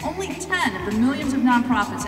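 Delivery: ordinary talk, nothing marked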